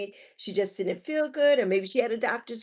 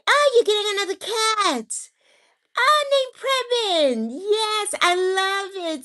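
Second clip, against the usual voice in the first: much higher, louder, slow gushing; excited delight; 11 semitones higher, 4 dB louder, slower than usual. Real, not acted. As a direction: gush over the new cat. Delighted reply to viewer's news.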